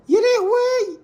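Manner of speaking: very high, held wail of shock; mock shriek. Onlooker seeing the animal escape, mock shrieking alarm. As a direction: squeal it like a hammed-up onlooker.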